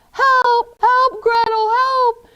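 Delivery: high, loud cries for help; yelling for help. Acted. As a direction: shout for your sister in panic. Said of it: "he's yelling for Gretel".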